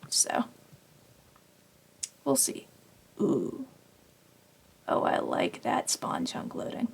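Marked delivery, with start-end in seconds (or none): none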